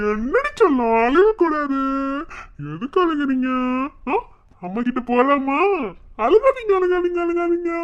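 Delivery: high, loud sing-song hushing; cooing baby talk. Acted. Uncle soothes crying newborn, high cooing baby talk. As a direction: coax the baby to stop crying.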